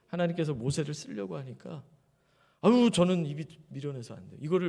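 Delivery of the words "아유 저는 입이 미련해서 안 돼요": louder whiny excuse, begging off; reluctant Moses. Acted. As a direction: sigh and beg off the task. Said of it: "모세를 봐요".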